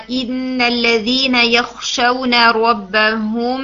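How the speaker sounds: slow, drawn-out tajweed recital of یخشون ربهم; measured melodic Quran reading; Quran recitation. Shown as a demonstration. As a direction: recite the verse slowly with full tajweed. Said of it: "ایک example ہے اخفا شفوی کی".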